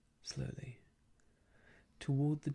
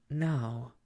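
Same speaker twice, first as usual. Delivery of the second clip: "now" punched out louder; stress on now; 5 dB louder than usual. Acted. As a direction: lean hard on "now". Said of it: "with an emphasis on the now".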